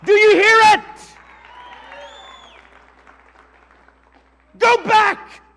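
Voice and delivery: very loud, high call; thundering pulpit cry; preacher's shout. Real, not acted. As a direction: shout each short phrase at full force.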